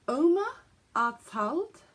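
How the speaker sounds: high, punched German words; attempted German pronunciation. Shown as a demonstration. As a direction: attempt German word by word, loudly. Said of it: "attempt the pronunciation of the colourway names".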